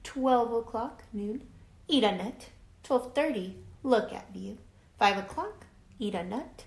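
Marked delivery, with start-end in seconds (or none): none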